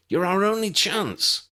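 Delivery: high, desperate shouted plea. A narrator's reading. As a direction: yell it across the room. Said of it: "he shouted".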